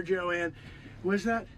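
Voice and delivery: ordinary talk, nothing marked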